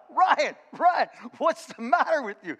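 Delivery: high, loud exasperated yell; exasperated shout. Retold or imitated. Coach yelling at his player over the cans. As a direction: shout his name like he's lost his mind.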